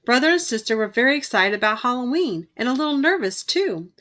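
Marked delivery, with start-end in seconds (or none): none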